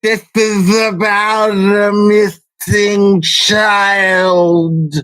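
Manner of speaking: stretched very slow mocking; slowed down deep. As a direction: drag every word out slowly. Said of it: "repeats quote stretched out".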